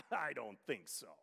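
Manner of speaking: scoffing chuckle, then a low dismissal; sarcastic scoff. Retold or imitated. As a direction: imitate a doubter laughing it off. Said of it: "he sarcastically scoffs".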